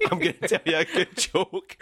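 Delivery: mocking imitation. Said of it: "stand-up comedians do not ... go".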